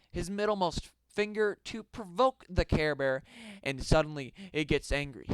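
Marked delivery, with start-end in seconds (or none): none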